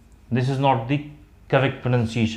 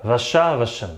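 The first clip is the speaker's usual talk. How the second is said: usual speaking voice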